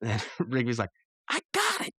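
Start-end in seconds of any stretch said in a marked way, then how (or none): 1.3-1.9 very high-pitched character voice; imitated cartoon friend. Rigby telling Skips his idea, very high voice. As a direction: squeak it out as a cartoon schemer.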